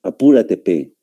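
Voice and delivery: "Apúrate, P" with a short "pe" for "pues"; Peruvian pronunciation. Shown as a demonstration. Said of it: "Así es como dicen".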